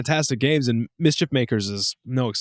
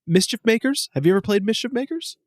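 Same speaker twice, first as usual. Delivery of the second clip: ordinary delivery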